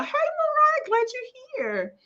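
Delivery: high, drawn-out sing-song hello; light up as you welcome her in; delighted welcome. Real, not acted. Welcoming a viewer by name.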